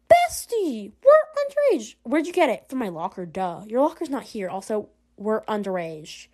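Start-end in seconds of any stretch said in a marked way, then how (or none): 0.1-2.0 high-pitched, scandalised squeal; mock-shocked protest. Friend objecting to smuggled wine, high-pitched and scandalised. 4.5-6.3 slow, soft, lower-key objection; muttered afterthought. Acted. Friend adding one more objection to the wine, soft and slow.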